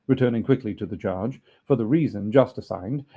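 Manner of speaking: usual speaking voice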